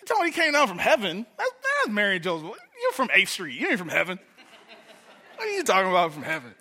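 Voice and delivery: loud, high Detroit west-side scoffing; incredulous Detroit neighborhood ribbing, laughing at the end; Detroit street talk. Retold or imitated. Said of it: "where I'm from, he was like".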